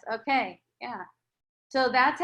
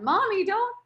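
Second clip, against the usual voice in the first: put-on higher child voice pleading with mom; child's plea; 8 semitones higher than usual. Child asking mommy to stop talking like that, a higher plea. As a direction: play the little kid asking mommy to stop. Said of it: "if it was your child and they were going".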